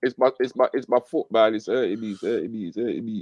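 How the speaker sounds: weak, whiny sick voice; fake sick voice. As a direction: moan it like a faked sick call. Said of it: "put on the sick voice saying how your foot's hurting".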